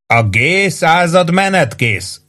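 slow, high shouted report; shouting down. A narrator's reading. Sergeant shouting down that the company is ready. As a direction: hurl the report down to the yard. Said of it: "kiabált le Warden".

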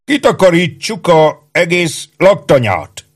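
high, slow, drawn-out orders; commanding troop boss. A narrator's reading. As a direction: lay down the orders like a self-important sergeant. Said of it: "parancsolóan előre szegezve". (parancsoló, vontatott, magas őrmesterhang)

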